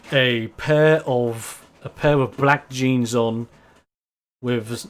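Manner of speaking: ordinary delivery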